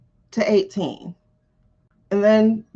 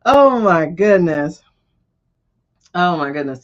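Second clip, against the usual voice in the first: laughing; 7 dB louder than usual. Real, not acted. Laughing at a comment.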